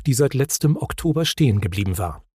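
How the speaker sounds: plain reading voice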